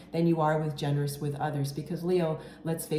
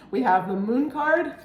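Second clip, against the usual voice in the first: excited; 7 semitones higher, 6 dB louder than usual. Real, not acted. Excited reveal of drawn card.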